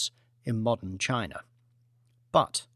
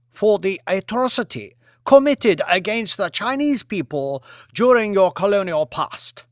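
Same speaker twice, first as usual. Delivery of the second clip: higher, louder smug demand; Premier's voice; 5 semitones higher, 6 dB louder than usual. Acted. Lee demands an apology from the king, higher and louder. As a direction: press the demand with a smile.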